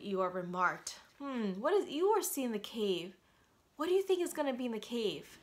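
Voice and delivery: ordinary delivery, nothing marked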